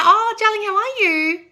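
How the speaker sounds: high, loud, delighted hello; excited hello to a returning viewer; gushing high greeting. Real, not acted. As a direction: welcome back a fan with a squeal.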